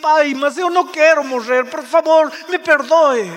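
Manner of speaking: mock-tearful begging to the father; tearful plea. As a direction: plead with God, voice pushed up. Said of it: "E você começa a chorar e diz".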